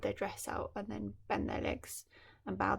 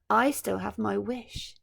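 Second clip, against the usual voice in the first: plain reading voice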